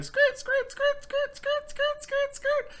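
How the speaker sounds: very high rhythmic squeak imitation; squeaking bed frame. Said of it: "it was squeaking every".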